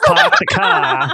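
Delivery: hammed-up Boston accent, loud and slow; high, drawn-out mock Boston vowels through laughter; thick Boston accent. Joking about a friend's character, hammed-up Boston accent. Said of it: "gonna have a thick Boston accent".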